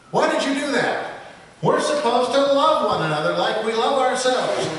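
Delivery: the Congregationalist scolding the donkey in a high voice; agitated. A narrator's reading. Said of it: "now very agitated".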